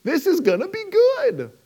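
high, gushing anticipation; hyped-up audience member, rising pitch; high excited chatter; eager crowd. Retold or imitated. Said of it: "Just going absolutely cra".

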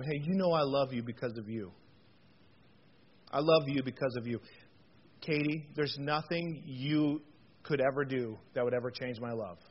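soft, tender reassurance; father softly reassuring his kids. Retold or imitated. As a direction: softly tell your child she is loved. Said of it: "Here's what I say to my kids".

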